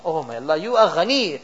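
ordinary talk

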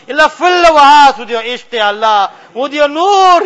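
ordinary delivery

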